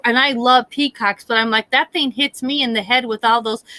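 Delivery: ordinary delivery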